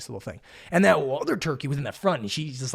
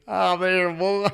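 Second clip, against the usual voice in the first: farmer chuckling; 2 semitones higher, much slower than usual. Retold or imitated. Old farmer watches driver trapped by turkeys, chuckling. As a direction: imitate an amused farmer chuckling. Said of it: "he's, like, laughing a little bit".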